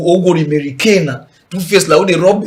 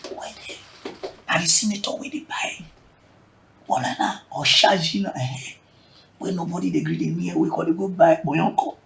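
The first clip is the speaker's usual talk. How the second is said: hushed aside; 3 semitones lower, 10 dB quieter than usual. Real, not acted. Gossipy aside to viewers.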